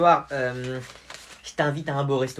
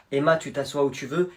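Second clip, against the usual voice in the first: ordinary delivery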